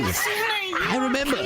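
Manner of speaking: high, drawn-out laughing words; amused recollection. Real, not acted. Player recalling Rupert's outburst, laughing.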